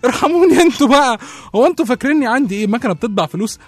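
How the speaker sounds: loud, high, indignant outburst; outraged protest. Acted. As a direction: throw her plea back at her, outraged. Outraged protest about money. (احتجاج عالي ومستنكر)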